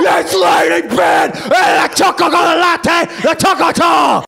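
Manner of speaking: drawn-out, high, full-throated yell; hyped-up shouting. Pretend. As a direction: shout it like a wild hype cry. Shouted hype catchphrases at the opening.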